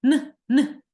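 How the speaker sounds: teacher sounding the letter aloud; sound demo. Shown as a demonstration. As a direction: sound out "Н, Н" crisply for the class. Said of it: "звук Н".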